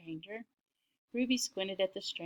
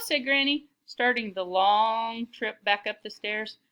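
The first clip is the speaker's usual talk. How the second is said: ordinary delivery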